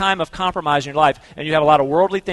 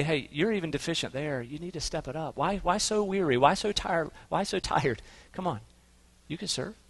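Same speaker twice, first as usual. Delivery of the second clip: soft gentle rebuke; 10 dB quieter than usual. Acted. Voicing Jesus gently rebuking.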